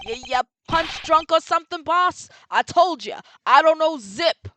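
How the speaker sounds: loud, gruff street tough refusing to talk; tough-guy boxer voice. Acted. Streamer voicing the boxer witness, loud tough guy. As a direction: play the stubborn palooka who won't rat.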